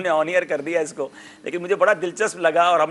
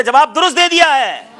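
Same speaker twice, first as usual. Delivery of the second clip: loud, high host's cry; ringing game-show proclamation; triumphant announcement; 7 semitones higher, 7 dB louder than usual. Real, not acted. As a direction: declare the correct answer to applause. Quiz host announcing correct answer.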